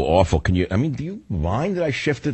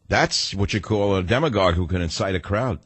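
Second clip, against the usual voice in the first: ordinary delivery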